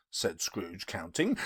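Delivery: usual speaking voice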